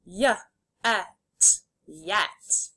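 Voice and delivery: "Y, et" as separate sounds, then "yet" whole; sounded out, blended. Shown as a demonstration. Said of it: "say the sounds and then blend".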